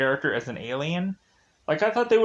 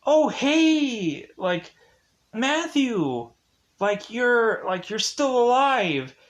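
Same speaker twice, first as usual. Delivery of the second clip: higher-pitched acted hello; delighted greeting; 6 semitones higher, slower than usual. Nancy spotting Matthew, delighted mock greeting. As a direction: imitate a relieved, happy greeting.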